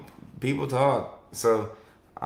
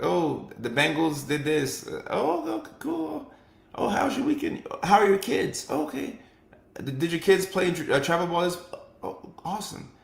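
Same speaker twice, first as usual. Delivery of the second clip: friendly coworker small talk; 7 semitones higher than usual. Retold or imitated.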